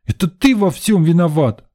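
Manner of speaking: put-on high-pitched child's accusation; sudden boyish outcry. A narrator's reading. Boy blames the man, crying out suddenly. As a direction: cry it out like an aggrieved boy. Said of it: "вдруг выкрикнул он".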